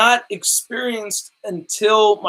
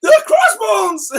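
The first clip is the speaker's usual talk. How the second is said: much higher, louder, slow fanboy squeal; geeked-out squeal; 5 dB louder, slower than usual. Retold or imitated. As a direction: squeal it like a starstruck fan. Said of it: "I geeked out".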